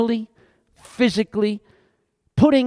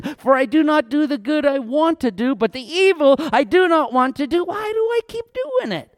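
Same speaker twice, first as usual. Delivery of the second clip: exasperated confession; 4 semitones higher than usual. Acted. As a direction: pitch climbing to a high, baffled question. Acted plea of struggling Paul.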